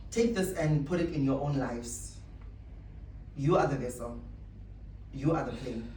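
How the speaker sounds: usual speaking voice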